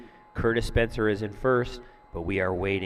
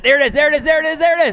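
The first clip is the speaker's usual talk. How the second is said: excited shout; 14 dB louder, much faster than usual. Real, not acted. Calling the double flip live.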